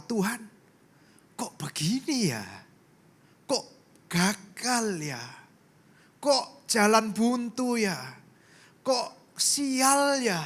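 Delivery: slow, rising plea to God; drawn-out, questioning lament; lamenting prayer. Retold or imitated. Pastor retelling his prayer to God, slow and pleading.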